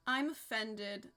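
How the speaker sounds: slow and sulky, mock hurt; mock offended. Shown as a demonstration.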